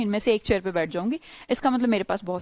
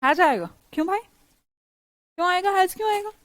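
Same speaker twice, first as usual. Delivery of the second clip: puzzled student; 7 semitones higher than usual. Retold or imitated. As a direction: play a confused kid asking why. Teacher voicing a puzzled student.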